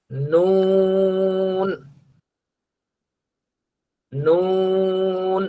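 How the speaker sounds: নুন held long with the tongue tip on the palate; drawn-out noon. Shown as a demonstration. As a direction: stretch নুন slowly. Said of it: "এরপরে". (টেনে নুন)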